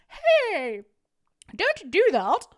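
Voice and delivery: high, loud Karen scolding. Acted. Streamer tries out a Karen voice for chat, high and loud. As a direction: pitch it up and loud like a bossy Karen. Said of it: "What's a good Karen voice?".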